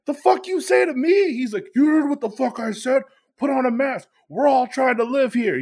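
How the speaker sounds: hammed-up heated comeback; roaring back. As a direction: roar back like a young lion, acted. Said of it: "roars back like a young lion".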